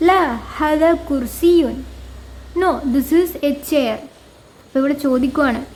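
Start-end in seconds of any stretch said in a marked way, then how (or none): 0.1-1.8 slow, careful Arabic answer with falling end; slow teacher reading; slow model answer. Shown as a demonstration. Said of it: "ഇല്ല". 2.6-4.0 slow model answer. Shown as a demonstration. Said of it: "ഇല്ല".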